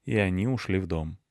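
ordinary delivery

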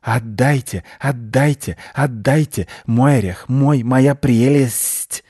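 a greedy creature crooning over its treasure; hissing. Acted. Squirrel hisses at the window, growing greedier. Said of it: "злобно цокать и шипеть".

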